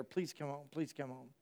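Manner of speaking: hushed, begging plea; mother kneels and pleads with her daughter, hushed begging; pleading. Retold or imitated. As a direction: softly beg a runaway daughter to return. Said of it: "beg her".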